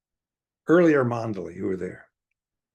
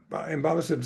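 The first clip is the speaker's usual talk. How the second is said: normal talking voice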